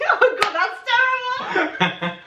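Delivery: high-pitched helpless giggling; words squeezed out through real laughter; laughing. Real, not acted. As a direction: crack up at your own terrible attempt. Cracks up at own accent attempt.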